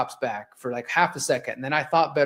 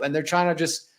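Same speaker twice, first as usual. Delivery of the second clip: ordinary delivery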